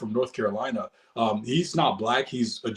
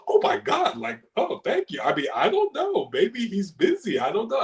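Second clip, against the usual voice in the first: put-on much higher, breathy girlish voice, flustered; imitating the Swedish girl; 11 semitones higher than usual. Imitating the blonde girl thanked at the bar, high and giggly. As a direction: play the flattered young woman, pitched way up. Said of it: "she's like".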